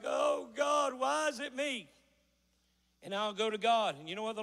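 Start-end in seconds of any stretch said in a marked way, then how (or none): 0.0-1.9 higher-pitched, imitated complaint to God; whiny plea, hammed up; mock whining. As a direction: act out a self-pitying wail, raised in pitch. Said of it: "every time I get to whining".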